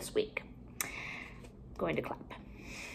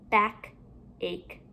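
BACK, a pause, then ACHE softer; two separate parts; 5 dB quieter than usual. Shown as a demonstration. Teacher building compound words for pupils, halves kept apart. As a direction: leave a gap between BACK and ACHE.

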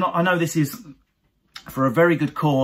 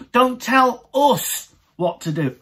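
higher, louder, slow defiance; mock northern woman; 8 semitones higher, 4 dB louder, slower than usual. Northern woman refusing orders, loud slow mock. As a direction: stretch out "Don't tell us" defiantly. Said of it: "They pluralise themselves".

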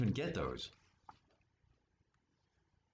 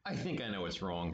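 lower, lightly voiced crow; a hint of a croaking bird; cawing crow; 8 semitones lower, faster than usual. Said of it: "he cawed".

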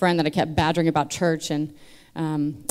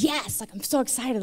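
excited cheer, retold; 6 semitones higher, 3 dB quieter than usual. Woman retelling her childhood relief at parents' divorce, excited. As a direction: relive a kid's delighted yes, lightly.

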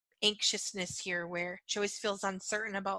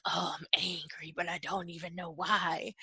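low, half-whispered self-talk; mock anger, voiced low and hushed; bewildered inner anger; 3 semitones lower than usual. Woman telling herself about sudden unexplained anger, low hushed voice. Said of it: "I feel all this anger inside of me".

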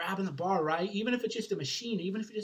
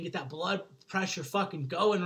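normal talking voice

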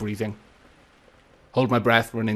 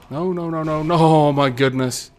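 panicked; 5 semitones higher, 8 dB louder than usual. Real, not acted. Getting hit from behind in fight.

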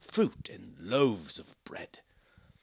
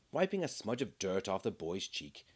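ordinary delivery

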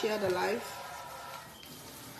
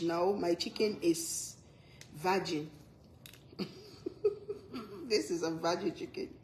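mock hushed defense; 4 semitones lower, 10 dB quieter than usual. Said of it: "laughing mock defense".

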